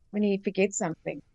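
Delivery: usual speaking voice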